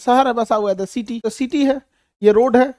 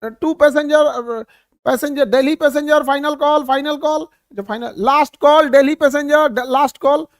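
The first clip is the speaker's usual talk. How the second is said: loud, raised boarding call over a mike; mike announcement; 4 semitones higher, 6 dB louder than usual. Retold or imitated. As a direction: shout the last call into the mike. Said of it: "mic लेके कहेगा". (Mic पर ज़ोर से आखिरी call की घोषणा)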